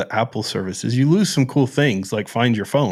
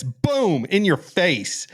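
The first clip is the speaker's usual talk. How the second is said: gloating; 6 semitones higher, much slower than usual. Real, not acted. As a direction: show it off like a winner. Gloating over his phone.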